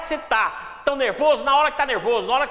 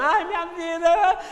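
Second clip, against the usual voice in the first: high, drawn-out mock wail; hammed-up tearful lament; mock crying; 7 semitones higher, 3 dB louder, slower than usual. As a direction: wail it like a self-pitying victim. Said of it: "na hora que você tá chorando".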